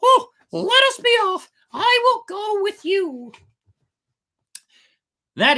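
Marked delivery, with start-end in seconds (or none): 0.0-3.3 very high, loud puppet voice, raring to go; eager puppet voice. A narrator's reading. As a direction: jump at the chance like a child. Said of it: "he said to the Fox and the Cat".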